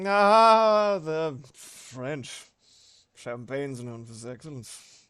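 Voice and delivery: loud drawn-out opening, sinking into a slurred mumble; pompous drunk pitchman losing steam; drunk Orson Welles. Acted. Streamer acts out the champagne ad outtake, drunk pompous impression. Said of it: "seen Drunk Orson Welles".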